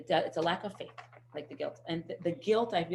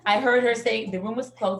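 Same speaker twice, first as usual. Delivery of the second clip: ordinary delivery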